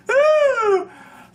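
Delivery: squealing laugh; high laughter. Real, not acted. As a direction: laugh helplessly. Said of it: "I can't say that without laughing".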